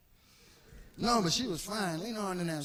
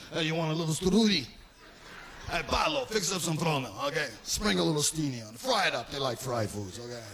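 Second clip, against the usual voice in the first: fast, lower Italian mobster host; doting mobster; 5 semitones lower, faster than usual. Retold or imitated. Mobster urges Paolo to feed the kid, fast and doting. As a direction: fuss over the kid like a mob uncle. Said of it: "kept trying to feed me".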